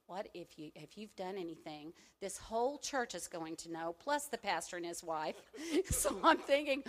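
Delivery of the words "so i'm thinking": laughing. Real, not acted. Laughing at her own fear.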